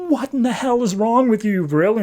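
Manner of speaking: high-pitched, exasperated outburst from the wife; retold angry wife. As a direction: throw the disbelieving question at him, furious. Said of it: "his wife comes in super pissed off".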